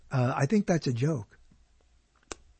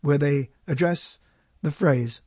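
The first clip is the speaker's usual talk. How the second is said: ordinary talk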